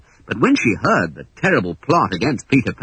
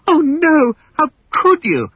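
much higher, louder horrified cry; Wendy aghast at Hook; 4 dB louder than usual. Acted. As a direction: plead in shock.

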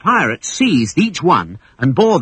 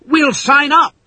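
much higher, louder frightened cry; cried out; 5 dB louder than usual. Acted. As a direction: blurt it out in fear. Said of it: "cried John and Michael".

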